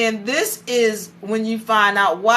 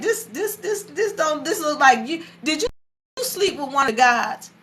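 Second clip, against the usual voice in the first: high-pitched, faltering suspicion; imitating a husband struggling to accuse his wife; stammering accusation; 6 semitones higher than usual. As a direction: stumble upward into an awkward accusation. Father accusing the mother, retold.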